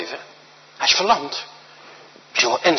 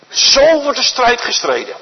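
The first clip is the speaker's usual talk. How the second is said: preaching at full force; 7 semitones higher than usual. Acted. Preacher driving home the refrain to his congregation, proclaiming slowly. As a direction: proclaim it slowly to the whole church.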